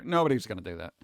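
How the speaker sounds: ordinary talk, nothing marked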